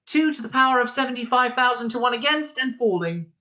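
put-on high, measured announcer-like girl; sensible girl's voice. A narrator's reading. Girl's voice calling out probability odds, sensible. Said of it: "Again came the voice".

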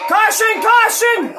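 high, loud cry of caution; real alarmed shout; urgent callout. Real, not acted. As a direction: call it out as the wreck happens. Wreck brings out caution flag.

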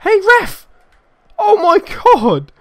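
very high, loud appeal; outraged yell. Real, not acted. Player to the ref over a decision, outraged. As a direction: shout up high at the official. Yelling at the referee.

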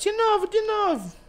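put-on very high childlike sing-song; squeaky toddler-TV voice, repeating it; Teletubbies voice. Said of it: "Tá igual o Teletubbies".

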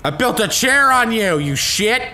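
high, loud gloating shout; loud gloating yell; triumphant yell. Real, not acted. Smashing enemy with chair.

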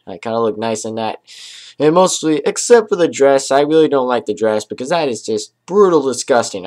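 normal talking voice

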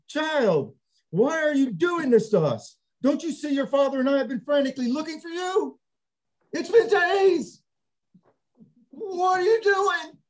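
high, loud frantic scolding, slowing at the end; a worried mom tearing into her runaway son; scolding mother. Retold or imitated. Mary scolding Jesus in front of the teachers, loud and frantic. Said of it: "Let me get the quote".

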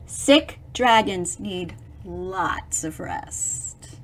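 plain reading voice

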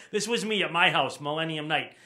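usual speaking voice

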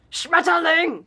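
loud, high barked SCHMETTERLING; hammed-up angry shout on SCHMETTERLING; mock angry German. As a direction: bellow SCHMETTERLING like a caricature of furious German. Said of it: "It's not this".

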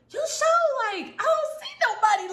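high-pitched, emphatic doubt; incredulous friend. Retold or imitated. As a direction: question it in disbelief, voice up high. Friend doubts her claim.

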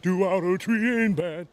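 slow, high belted rock ballad line. Retold or imitated. Singer demoing a voice for the interviewer, belting big and slow. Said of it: "that big voice".

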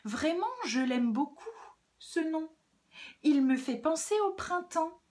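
high, dreamy sweetness; sugary put-on niceness; extra-kind voice. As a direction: gush gently, as if truly charmed. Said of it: "d'une voix encore plus gentille".

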